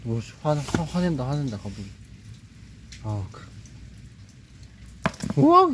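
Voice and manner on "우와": high, loud startled cry; real surprise, jumping up high; startled wow. Real, not acted. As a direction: caught off guard as it jerks. Turtle suddenly moves.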